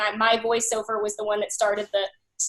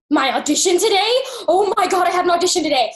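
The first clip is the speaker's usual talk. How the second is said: higher and louder in a rush of alarm; sudden panic; 6 semitones higher, 5 dB louder than usual. Retold or imitated. Realizing mid-call with boyfriend she missed audition, panicked. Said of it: "the panic I remember".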